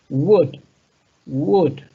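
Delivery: "would" said as wud, the L dropped; would without L. Shown as a demonstration. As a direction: say "would" with a silent L. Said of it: "to omit the L".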